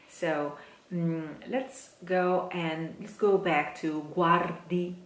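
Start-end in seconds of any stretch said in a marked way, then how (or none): none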